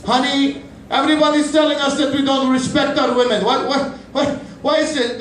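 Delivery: high, playful husband voice; cheerful reassuring husband, pitched high; husband to wife. Acted. Comic husband addressing wife.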